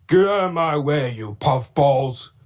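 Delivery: slow, milder villain yell with a hint of James; own Diesel 10 voice. Shown as a demonstration. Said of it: "a little bit of a James tone into it".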